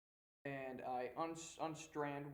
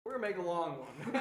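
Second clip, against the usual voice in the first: laughing; 3 semitones higher, 8 dB louder than usual. Real, not acted.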